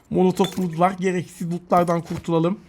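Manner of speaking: ordinary delivery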